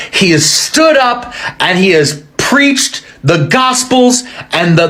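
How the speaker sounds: ordinary delivery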